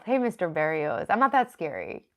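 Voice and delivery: ordinary talk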